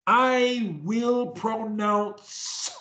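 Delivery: "I will pronounce" high and very slow, long final s sound; exaggerated pronunciation. Shown as a demonstration. Coach modelling for a stutterer, slow and over-articulated. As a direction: stretch "pronounce" into two long syllables. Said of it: "do the pronunciation the same way I do it".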